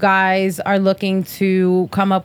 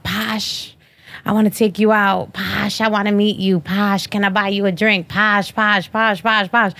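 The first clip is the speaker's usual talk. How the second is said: mocking DM guys. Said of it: "talking about".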